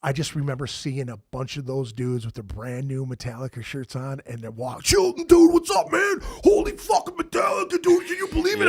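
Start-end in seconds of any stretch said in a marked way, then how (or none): 4.8-8.7 loud, high-pitched mock fanboy shouting; mock hype, way up high and loud; overexcited fan impression. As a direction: holler like a gushing metalhead bro. Mimicking overexcited new Metallica fans.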